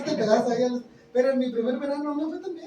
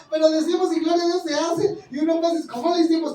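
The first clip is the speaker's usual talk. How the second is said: rising fervour; 6 semitones higher, 6 dB louder than usual. Real, not acted. Preacher marvels at the congregation's faith.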